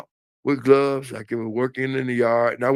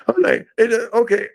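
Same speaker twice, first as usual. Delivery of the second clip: laughing; 11 semitones higher than usual. Real, not acted. Laughs at own story.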